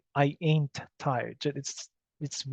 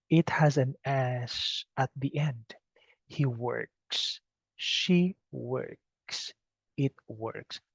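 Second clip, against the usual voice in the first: whisper; 4 dB quieter, slower than usual. Shown as a demonstration.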